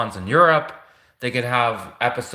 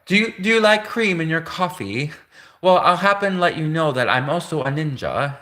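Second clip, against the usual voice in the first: hammed-up posh British commercial voice, pitched higher; joking British accent, like a BBC advert; mock British accent; 5 semitones higher than usual. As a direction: play a British TV ad ninja in a put-on British accent. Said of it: "like, a B B C commercial or something".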